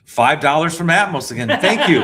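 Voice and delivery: laughing. Real, not acted. Laughing thanks for a donation.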